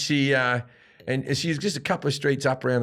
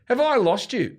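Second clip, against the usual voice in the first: high, raised call out to the crowd; 10 semitones higher, 3 dB louder than usual. Retold or imitated. Comic to the studio crowd, loud raised challenge. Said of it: "I turned to the crowd and went".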